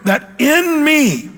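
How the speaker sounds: "in me" slow, with "in" pitched high; stress on "in". Shown as a demonstration. Preacher showing the huge preposition, "in" stressed high and slow. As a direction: draw out and lift "in" before "me". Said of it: "the preposition there is huge".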